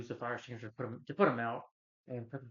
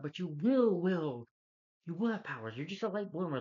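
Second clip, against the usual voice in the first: louder and higher, pushing encouragement; dad insists his son will get powers; eager dad; 6 semitones higher, 4 dB louder than usual. Retold or imitated. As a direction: pump the kid up, louder and higher.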